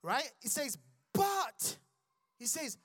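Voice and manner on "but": But, high and loud; high exclaimed but. Real, not acted. Preacher proclaiming God's big but, high and loud. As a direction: ring it out like good news. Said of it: "preacher exclaims the key word".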